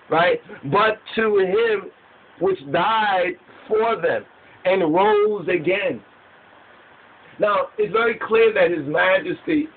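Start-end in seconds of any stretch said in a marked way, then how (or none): 0.7-6.0 slow, raised-pitch declaiming with pauses; scripture recitation. Acted. Preacher explaining that one died for all, emphatic recitation. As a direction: preach the verse phrase by phrase.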